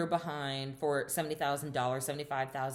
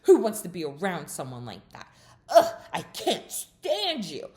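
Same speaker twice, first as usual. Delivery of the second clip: imitated angry rant; 3 semitones higher, 6 dB louder than usual. Shannon attacks Katie at dinner, rising to a loud disgusted cry. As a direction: spit the last line out in loathing. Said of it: "So then Shannon continues".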